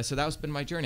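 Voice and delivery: ordinary talk, nothing marked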